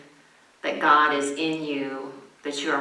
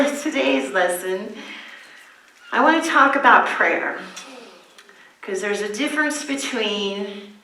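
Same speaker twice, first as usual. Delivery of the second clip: usual speaking voice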